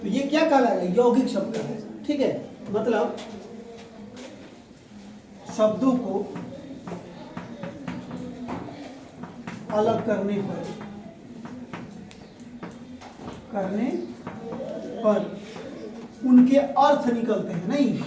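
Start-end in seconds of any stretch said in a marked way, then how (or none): 5.6-15.3 slow dictation, very long pauses between phrases; dictating. Real, not acted. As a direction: give students time to write each bit.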